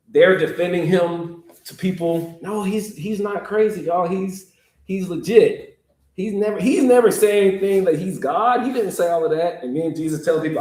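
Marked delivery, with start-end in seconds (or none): 2.4-9.6 high, earnest insisting; eager defense. Acted. Disciples defending Jesus to the crowd, high and insistent. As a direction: talk them into believing him.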